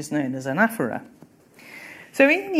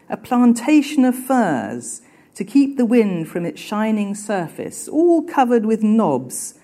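raised, deliberate reading voice lifting the key phrases; literary reading aloud; 4 semitones higher, 4 dB louder, slower than usual. A narrator's reading. Teacher reads the Dickens opening to students, emphatic reading voice. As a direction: read the classic novel to the class with weight.

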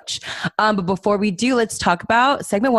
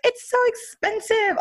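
imitating mom. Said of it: "she was like".

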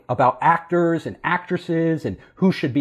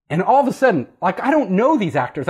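ordinary delivery

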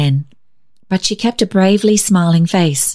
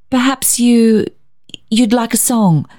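stammering; 6 semitones higher than usual. A narrator's reading. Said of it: "stammered Barbara".

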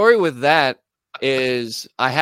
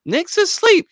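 very high, squeaky mock female voice; girlfriend voice; 3 dB louder than usual. Girlfriend at the door says he's sleeping, high-pitched imitation. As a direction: jump way up in pitch to imitate her.

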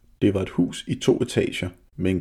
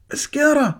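very high, loud, startled cry; alarmed shout; 6 dB louder than usual. A narrator's reading. Mathias yelling to Jakob in the window, alarmed. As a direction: yell out in sudden fright. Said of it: "råbte Mathias".

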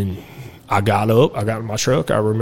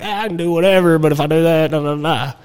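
mock careless, pitched up; a cocky kid voicing his own thoughts, lightly mocked; careless inner voice; 6 semitones higher than usual. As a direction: toss it off like nothing can touch you. Voicing his younger careless self.

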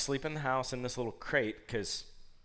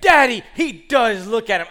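protesting daughter; 9 semitones higher, 15 dB louder than usual. Retold or imitated. Daughter arguing with dad about rabbit, loud protest. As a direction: imitate a child insisting at full volume.

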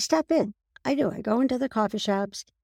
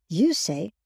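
ordinary talk, nothing marked

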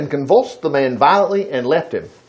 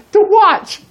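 excited punchline; 3 dB louder than usual. Real, not acted. Punchline after rhetorical question.

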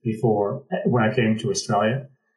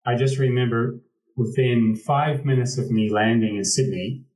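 normal talking voice

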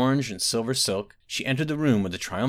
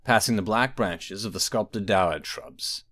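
ordinary delivery, nothing marked

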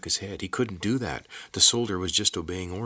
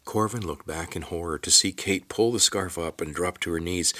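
ordinary delivery

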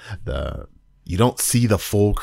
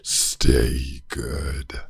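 lower, guttural monster voice; guttural creature voice; 5 semitones lower, 3 dB quieter than usual. Retold or imitated. Reviewer imitating the creature's farewell, low guttural voice. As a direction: growl it low like the Christmas creature. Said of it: "in that guttural voice".

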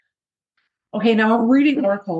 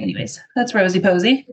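ordinary talk

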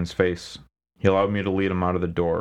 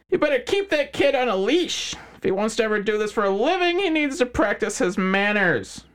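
smug crime boss. Acted. McHines voiced character.

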